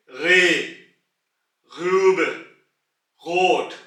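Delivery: रे ग्रूब गोट loud, each with a rasped throat ग; throaty R examples. Shown as a demonstration. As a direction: hit each word with a scraped throat ग. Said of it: "throat की back से निकालेंगे". (रे, ग्रूब, गोट गले वाले ग के साथ ज़ोर से)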